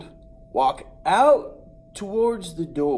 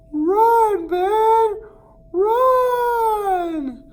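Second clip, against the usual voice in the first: much higher, louder crying yell; crying shout; 8 dB louder, much slower than usual. Retold or imitated. The distant cousin cries out for the boy to run. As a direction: wail the warning. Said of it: "he's like".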